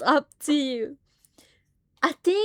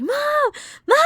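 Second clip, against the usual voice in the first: high, urgent repeated call; screaming mom; 13 semitones higher than usual. Retold or imitated. Running downstairs to mom, high frantic calls. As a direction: shriek mom twice while running. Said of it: "I went screaming".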